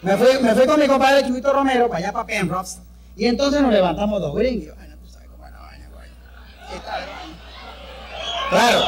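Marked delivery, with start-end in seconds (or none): 4.8-6.1 sudden hushed whisper; conspiratorial whispered aside. Acted. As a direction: drop to a secret whisper. Whispered aside in comedy routine.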